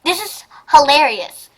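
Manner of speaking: usual speaking voice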